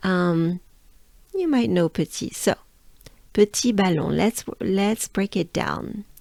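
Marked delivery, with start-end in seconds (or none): none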